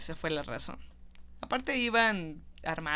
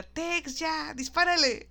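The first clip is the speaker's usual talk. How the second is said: mock yell; 8 semitones higher, slower than usual. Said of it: "Sadie le grita".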